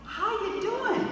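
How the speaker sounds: slow high sing-song question; lightly voiced spouse asking; mock spouse greeting. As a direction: gently mimic the spouse. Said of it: "They say something like".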